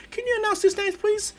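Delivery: very high little voice; polite kid's request. Acted. Student asks announcer for a favour, high polite voice. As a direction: ask sweetly in a high pitch.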